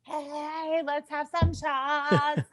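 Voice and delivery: high, soft, drawn-out singsong; imitated far-off cheer, slow and high; mock party call. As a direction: stretch it out high and light. Retells cheering on drinks meanwhile.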